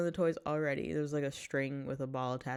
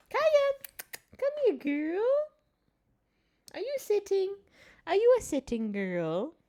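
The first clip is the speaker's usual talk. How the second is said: coaxing the cat; 7 dB louder than usual. Real, not acted. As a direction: get the cat's attention with a high voice. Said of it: "I do this to get their attention".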